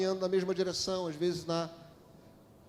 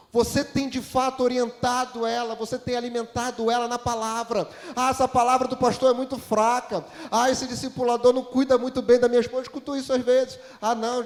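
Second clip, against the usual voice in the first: raised, higher preacher's voice; impassioned preaching; 6 semitones higher, 7 dB louder than usual. Real, not acted. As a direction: press the congregation with loud, insistent questions. Preaches at full force.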